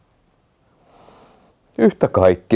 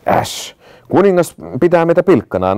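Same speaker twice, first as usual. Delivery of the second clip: a higher, peevish mock grumble; grumbling maidens; 8 semitones higher than usual. A narrator's reading. Bored maidens grumbling to each other about the king, in a mild peevish tone. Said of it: "napisivat neidot keskenään".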